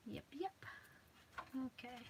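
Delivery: soft whispered yep; whispering. Real, not acted. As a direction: whisper to yourself. Whispered aside.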